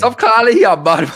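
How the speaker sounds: high, loud laughing objection; laughing protest. Real, not acted.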